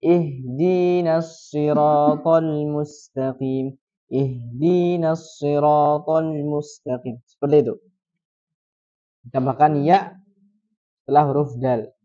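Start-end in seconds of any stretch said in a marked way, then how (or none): 0.0-7.2 very slow, the di in Ihdinas drawn long; slow Quranic recitation. Shown as a demonstration. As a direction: chant Ihdinas siratal mustaqim slowly. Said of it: "Dipanjang dinya".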